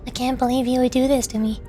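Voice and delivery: ordinary talk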